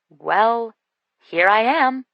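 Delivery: lamely, with a rise. A narrator's reading. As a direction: say it weakly, a bit awkward. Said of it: "I said lamely".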